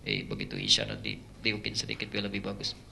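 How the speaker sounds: the devil slyly whispers to the imam; whispered devil. Retold or imitated. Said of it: "Masuk setan".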